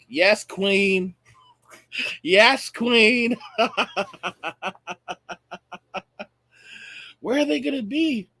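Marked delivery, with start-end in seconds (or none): 0.1-3.3 mock cheer with drawn-out vowels, building louder; mocking catchphrase. Mocking catchphrase, then laughs. 3.4-6.2 long staccato cackle, trailing softer; mocking laugh. Real, not acted. Laughs at own mockery.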